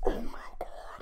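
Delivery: stunned, hushed whisper; aghast whisper. Retold or imitated. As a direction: whisper it in disbelief. Aghast reaction, quoted.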